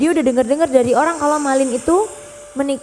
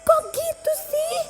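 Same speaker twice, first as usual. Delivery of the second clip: disgusted snob; 13 semitones higher than usual. Acted. Rich wife mocking the old mother, disgusted. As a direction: squeal your contempt like a spoiled rich girl.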